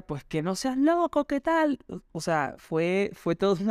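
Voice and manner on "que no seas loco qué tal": higher mock yell through a door; mild hammed-up shout, pitched up; mother yelling from outside. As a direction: call out high like a mother shouting from outside the house. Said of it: "Y mi mamá desde fuera".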